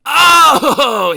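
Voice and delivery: high, loud delighted oh; thrilled at the plan; gleeful oh. Acted. Mister Tickle delighted by Mister Happy's idea, high and loud. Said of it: "he grinned".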